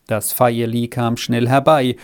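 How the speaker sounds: ordinary delivery, nothing marked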